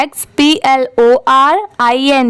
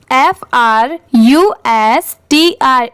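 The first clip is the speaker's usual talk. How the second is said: slow spelling drill; much slower than usual. Shown as a demonstration. Teacher spelling slowly for students to repeat along. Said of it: "आप मेरे साथ एक बार repeat करिए".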